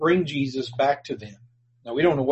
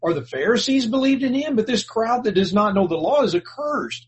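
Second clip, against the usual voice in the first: higher, indignant rebuke; mock-scornful religious leaders; scornful Pharisees; 6 semitones higher, 3 dB louder than usual. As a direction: scold like a haughty ruler. Pharisees scolding the soldiers.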